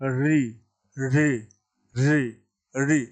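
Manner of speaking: री held with the tongue curled back; demonstrated retroflex. Shown as a demonstration. Teacher showing students by saying it, retroflex री demonstration. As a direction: repeat री singly, tongue reaching back to the palate's root. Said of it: "बोल के दिखाता हूँ मैं".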